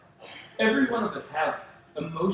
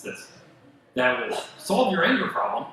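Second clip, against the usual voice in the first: ordinary delivery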